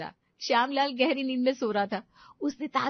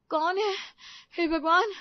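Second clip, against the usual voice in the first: high, loud startled cry; startled fright; 9 semitones higher, 5 dB louder than usual. Acted. Woken daughter-in-law startled at the door, frightened cry. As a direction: gasp it out in sudden fear. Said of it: "रिया घबरा कर उठी".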